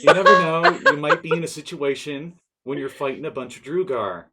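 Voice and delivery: loud, laughing nagging mom. Pretend. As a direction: nag while laughing.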